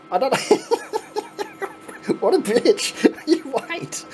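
high voice breaking into laughter; amused mock threat, squeezed out between laughs; helpless laughing. Real, not acted. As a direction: talk through a real laughing fit. Cracking up at friend's prank.

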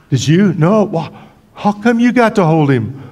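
shepherds squabbling. Pretend. Shepherd jealously demanding why the other held Jesus. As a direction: quarrel like kids over a baby.

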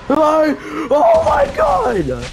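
high shrieking scream, drawn out; terrified scream. Real, not acted. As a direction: scream as it catches you. Caught by monster.

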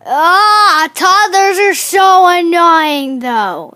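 loud, slow complaining shout; loud complaint. Real, not acted. Gamer groaning to viewers about toddlers, loud slow complaint. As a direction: belt it out like a fed-up gamer.

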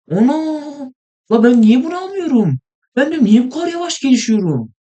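high, loud cry of disbelief; shocked outburst. Real, not acted. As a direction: yelp it out in real disbelief. Gamer shocked at slow progress.

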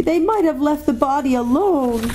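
high, tearful protest; acted child's grieving complaint; sobbing girl. A narrator's reading. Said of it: "sobbed Lucy".

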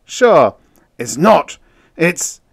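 loud, high, broken off; frustrated protest. A narrator's reading. Outpost man failing to explain himself, frustrated. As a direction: burst out, then stop short.

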